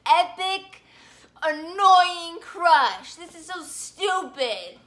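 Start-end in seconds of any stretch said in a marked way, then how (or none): 0.0-3.1 building exaggeration. Vlogger ranting about her crush, building to a loud slow whine. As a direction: pile it on until the last word is a slow loud wail.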